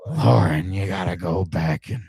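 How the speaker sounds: put-on low, unhurried old-cowboy voice; Clint Eastwood. Old cowboy lecturing co-host, low impression. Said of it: "That's the old cowboy".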